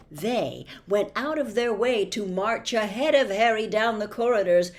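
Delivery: ordinary delivery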